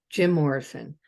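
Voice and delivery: usual speaking voice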